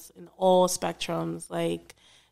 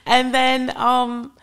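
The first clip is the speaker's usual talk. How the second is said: put-on louder, flustered British accent; 4 semitones higher, 5 dB louder than usual. Co-host struggling through the social media plugs, British accent. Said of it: "close out in your British accent".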